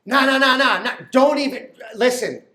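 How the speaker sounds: urgent protest. Acted. Stopping wife from landing.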